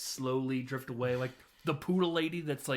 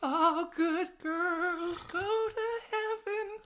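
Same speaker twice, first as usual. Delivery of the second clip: very high, slow, drawn-out falsetto; mock high-pitched rhyme; much slower than usual. Catwoman finishing her counting rhyme, slow falsetto imitation. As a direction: stretch every word out in falsetto.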